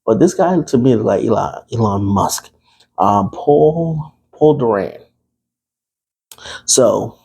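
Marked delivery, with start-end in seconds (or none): none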